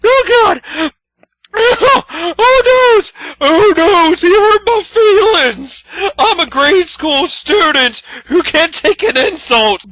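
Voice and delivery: high, whiny mock sobbing crybaby; hammed-up whiny crybaby imitating the critic. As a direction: play the hurt baby to mock him. Mocking the critic's yelling.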